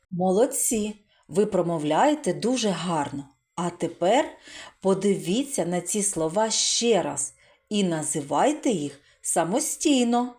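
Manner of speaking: normal talking voice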